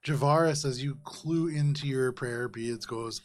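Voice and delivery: ordinary talk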